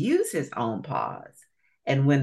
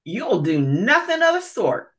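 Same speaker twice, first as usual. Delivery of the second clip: higher, louder rude interruption; rude alligator; 8 semitones higher, 5 dB louder than usual. Acted. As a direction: cut him off, impolite. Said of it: "interrupted the other, and not very politely either".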